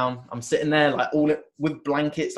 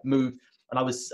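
ordinary talk, nothing marked

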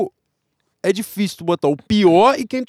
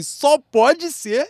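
drawn-out; 7 semitones higher, much slower than usual. Retold or imitated. Mimicking a hesitant guess.

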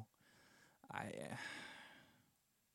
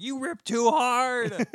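high, loud, slow hammed-up line; mock Thor voice; 12 semitones higher, 12 dB louder, much slower than usual. Imitating Thor scolding Zeus in a high put-on voice. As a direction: squeal it like a flustered Thor.

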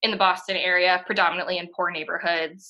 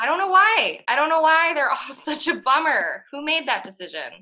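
exasperated, higher and louder, with a laugh; 6 semitones higher, 3 dB louder than usual. Real, not acted. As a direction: throw your hands up in disbelief. Exasperated about bleak Boston movies.

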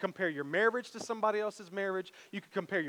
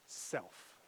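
much softer, lower, whispered final word; 14 semitones lower, 14 dB quieter than usual. Real, not acted. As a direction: drop to a breathy whisper. Sermon climax, dropped to breath.

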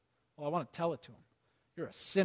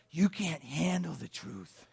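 bad Jack Nicholson; 4 dB quieter, slower than usual. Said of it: "It's a really bad Jack Nicholson".

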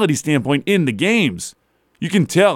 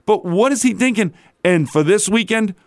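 ordinary delivery

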